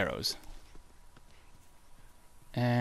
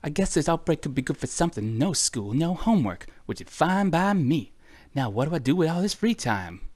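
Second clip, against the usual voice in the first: higher-pitched character reading; put-on diary voice; 6 semitones higher than usual. Player voicing a kid glad of no homework, high put-on voice. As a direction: lift the voice high to play the diary's writer. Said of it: "why I'm giving that voice".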